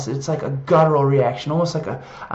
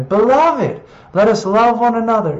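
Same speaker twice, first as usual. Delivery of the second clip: emphatic proclamation; 5 semitones higher, 6 dB louder than usual. Shown as a demonstration. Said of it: "reading scripture emphatically".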